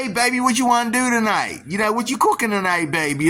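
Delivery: high, cheerful husband calling to his wife; a happy man asking his baby what's for dinner. Retold or imitated.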